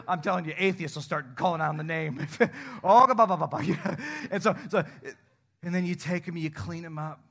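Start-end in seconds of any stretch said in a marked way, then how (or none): 2.8-4.0 mock gagging. Gagging at toddler mess.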